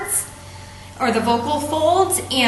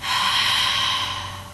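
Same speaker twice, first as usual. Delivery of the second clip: long open-mouthed haa breath out, no voice; fog the mirror exhale; 4 dB quieter than usual. Shown as a demonstration. Yoga teacher showing the class the fogging breath, a breathy haa. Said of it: "let it out through your mouth like you were trying to fog the mirror".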